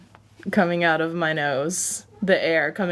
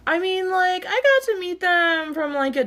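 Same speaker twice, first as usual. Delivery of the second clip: high-pitched; 11 semitones higher than usual. Real, not acted. Sudden high-pitched delivery.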